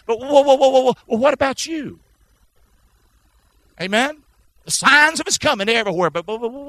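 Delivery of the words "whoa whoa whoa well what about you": ordinary talk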